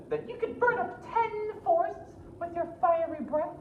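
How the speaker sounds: very high, sweet princess; high flattering princess. Acted. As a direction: flatter the dragon in a high girlish voice.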